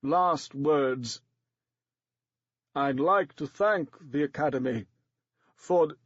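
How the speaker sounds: lightly shivering actor's voice; dying actor mustering last words, mildly shaky. A narrator's reading. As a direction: shake through the words. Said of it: "shivering and shaking violently".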